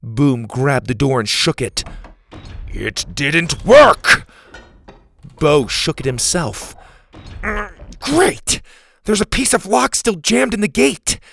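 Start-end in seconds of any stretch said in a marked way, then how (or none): 2.7-4.3 slow, loud, booming complaint; dismayed bellow. Acted. Boom shaking the stuck door, dismayed bellow. Boom's gate will not open. 8.0-11.2 high-pitched, frustrated outburst; exasperated cry. Acted. Bo shaking the jammed gate, exasperated cry.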